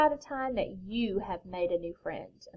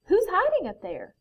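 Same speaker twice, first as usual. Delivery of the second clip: high, louder storybook call; 9 semitones higher, 5 dB louder than usual. A narrator's reading. Bear to the hidden stranger in the tree, calling up.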